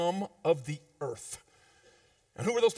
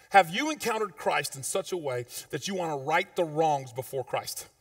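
ordinary talk, nothing marked

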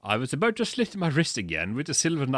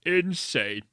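ordinary delivery

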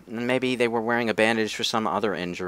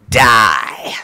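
villain shout; 8 dB louder than usual. Acted. Villain flower attacks.

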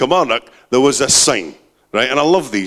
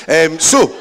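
ordinary talk

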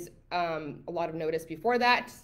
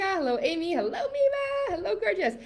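high sing-song greeting; 11 semitones higher than usual. Real, not acted. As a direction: stretch it up high and sweet.

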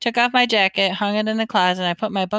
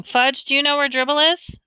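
usual speaking voice

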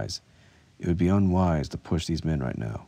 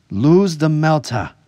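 high, loud, slow threat; harsh shout; 10 semitones higher, 10 dB louder, slower than usual. A narrator's reading. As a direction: bark an order from the doorway. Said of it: "a harsh voice shouted".

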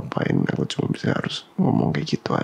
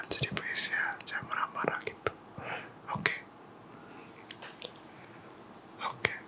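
put-on whispered, tender boyfriend reassurance; soft comforting whisper; 5 semitones higher, 10 dB quieter than usual. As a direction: soothe her close to the mic. (bisikan lembut menenangkan pacar)

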